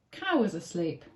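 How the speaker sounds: ordinary delivery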